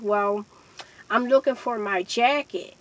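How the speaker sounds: usual speaking voice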